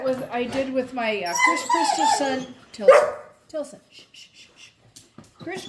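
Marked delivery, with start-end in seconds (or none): 1.4-2.5 excited squeal. Real, not acted. Excited over brand. 2.7-3.8 calling the dog. Real, not acted. 3.9-4.7 whispered shh to calm a dog; shushing. Real, not acted.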